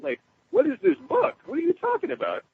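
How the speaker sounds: high-pitched mock bewilderment; incredulous questioning. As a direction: retell it baffled, voice leaping up high. Retold bewildered questioning of the guide.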